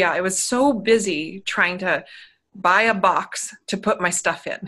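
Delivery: ordinary delivery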